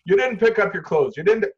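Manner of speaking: loud, high parental telling-off; nagging scold. Acted. Parent at front door to child, angry nagging. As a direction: scold the kid over the mess. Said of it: "negative activation".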